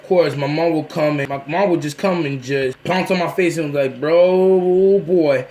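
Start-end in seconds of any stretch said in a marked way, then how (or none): 4.0-5.4 loud, drawn-out mock-mom warning; hammed-up exasperated parent; scolding outburst. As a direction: pounce on it loudly and stretch the words. Mom pouncing in the retold story.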